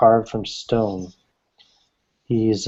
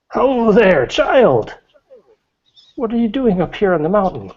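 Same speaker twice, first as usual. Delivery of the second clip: put-on much higher, cheery old hermit voice; old woodcutter voice; 11 semitones higher, 3 dB louder than usual. Game master voicing the old woodcutter greeting a stranger, high cheery old voice. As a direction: greet the stranger like a jolly old mountain man.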